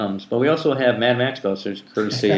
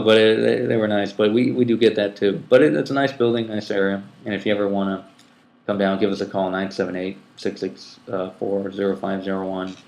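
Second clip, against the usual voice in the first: normal talking voice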